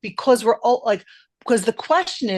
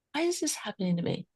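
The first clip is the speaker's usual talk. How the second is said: quick quiet repeat, words pushed; faster, softer take; 10 dB quieter, faster than usual. Shown as a demonstration. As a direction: murmur it again quicker. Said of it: "And watch this".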